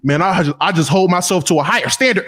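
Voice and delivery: low, mumbly Russ impression; imitating Russ. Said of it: "look in the camera and be like".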